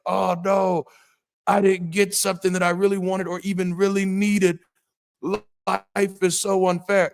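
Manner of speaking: hammed-up poor-me complaint; mock whining. Mocking his old self griping that life is unfair, whiny.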